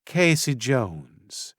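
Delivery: slow Southern drawl; Southern florist introducing herself slowly. A narrator's reading. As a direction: stretch out the name, Southern. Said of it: "she replied in her southern drawl".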